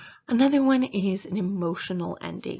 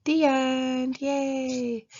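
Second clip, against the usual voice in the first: mock cheer; 6 semitones higher, much slower than usual. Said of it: "the dialogue ending is".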